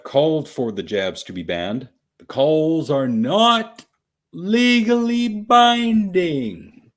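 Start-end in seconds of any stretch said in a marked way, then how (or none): 2.2-6.9 very slow, loud, high-pitched emphasis; stretched-out, raised-voice insistence so everyone gets it; slow and emphatic. Real, not acted. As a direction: spell it out loudly, word by drawn-out word. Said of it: "I have to say this slowly".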